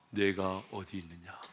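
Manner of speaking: low, soft and tender; gentle, conversational question. Acted. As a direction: drop to a quiet voice and ask lovingly. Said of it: "큰 소리로 외치지 않았습니다". (낮고 부드럽게 묻기)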